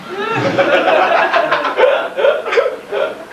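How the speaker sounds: long high airy laugh; high breathy laugh. Real, not acted. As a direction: crack up at the story. Laughs at bank story.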